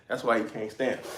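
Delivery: ordinary talk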